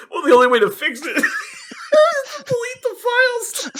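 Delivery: quoting a friend while laughing, high and slow; laughing quote. Retold or imitated. Retelling a friend's bad fix, laughing high. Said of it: "He goes".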